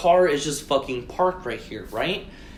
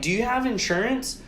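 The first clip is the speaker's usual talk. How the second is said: higher, rising question; pointed questioning; 8 semitones higher than usual. Retold or imitated. Driver questions the staring car owner, pointed rising question. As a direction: ask as if he may not understand.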